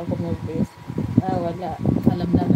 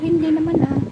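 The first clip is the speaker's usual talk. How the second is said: mock protest, pitched up and louder; half-laughing denial, raised higher; defensive denial; 10 semitones higher, 4 dB louder than usual. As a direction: brush off the teasing with a pitched-up protest. Retold reply to teasing partner.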